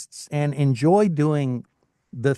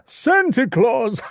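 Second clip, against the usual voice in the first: much higher, slow, loud jolly Santa voice; Santa Claus; 10 semitones higher, 4 dB louder, much slower than usual. Shown as a demonstration. Voice actor demoing a Santa Claus voice. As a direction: boom it out like a merry Santa.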